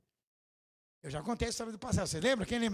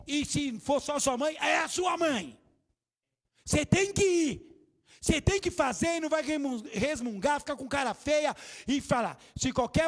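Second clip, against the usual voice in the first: loud scolding; 6 semitones higher, 4 dB louder than usual. Real, not acted. Pastor scolding disobedient children from the pulpit, shouting. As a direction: thunder at the congregation like a stern pastor.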